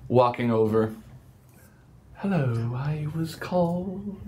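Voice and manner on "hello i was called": slow, soft, dreamy sloth voice; serene sloth. Acted. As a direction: drawl it out like a sleepy sloth. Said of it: "very slowly a very serene-looking sloth".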